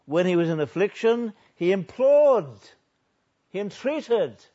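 loud verse reading, key verbs punched; emphatic pulpit reading; forceful preaching. Real, not acted. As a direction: proclaim it with rising urgency.

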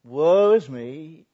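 loud, very slow lament; anguished cry. Acted. Isaiah crying out before God, loud slow lament. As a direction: wail it out in despair. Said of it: "Then I said".

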